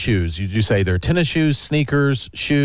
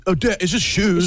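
put-on higher, airy teen brush-off; mock teen voice; 7 semitones higher than usual. Mimicking the kid brushing off dad. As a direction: toss it off like a bored teen. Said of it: "you say, just".

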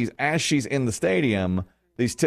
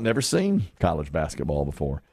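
ordinary talk

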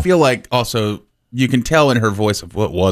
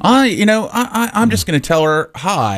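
higher, hesitant would-be charmer; young Chris Kelly; 8 semitones higher than usual. Retold or imitated. Co-host teases the host as a young suitor, mock innocent. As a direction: stammer through an innocent thought, pitched up. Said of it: "could see a young Chris Kelly thinking".

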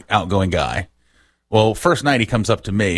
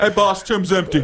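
playful guard voice; 10 semitones higher than usual. Acted. Joke voice of tomb guard.

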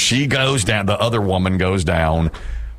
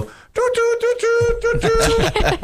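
tiny, very high-pitched mouth-made toots; imitated horn toots; slower than usual. Mother voicing the horn instead of blowing it, daintily. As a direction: squeak out a polite little car horn. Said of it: "she would say that with her vo".

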